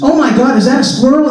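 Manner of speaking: mock startled cry at a squirrel; fake excitement. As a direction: sell the distraction loudly. Mouse deer fakes distraction.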